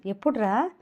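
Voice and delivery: ordinary delivery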